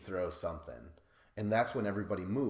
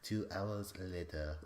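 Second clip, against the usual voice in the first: put-on slow, softer French-accented narrator; 5 dB quieter, slower than usual. Podcaster mimicking SpongeBob's time-card narrator, slow French accent. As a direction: imitate the French cartoon narrator, drawing it out. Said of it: "The French guy's like".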